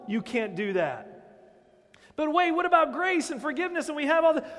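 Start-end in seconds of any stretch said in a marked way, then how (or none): 2.2-4.4 mock objector.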